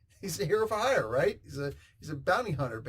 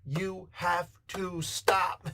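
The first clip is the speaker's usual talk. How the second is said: "You have to stop" slow and higher, every word separate; word-by-word emphasis; 4 semitones higher, much slower than usual. Shown as a demonstration. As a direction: punch each word of "You have to stop" with a gap between. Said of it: "symbol in between each word".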